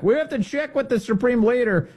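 high-pitched parody of a stalling official; mock deference; mocking imitation. Mocks Iranian officials stalling.

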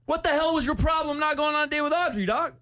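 high-pitched mock confrontation; acting tough. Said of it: "sound like I was tough".

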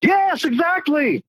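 high, drawn-out excited yes; thrilled, emphatic confirmation; elated agreement. Real, not acted. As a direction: agree with delight, stretching it out. Caller eagerly agrees about free money.

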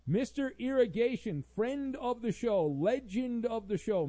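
high-pitched, punched-up announcer billing; hype introduction. Acted. Host introducing a regular listener's message, hyped announcing. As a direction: herald a legend with showy fanfare.

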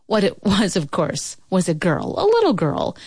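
ordinary delivery, nothing marked

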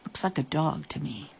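quiet aside. A narrator's reading.

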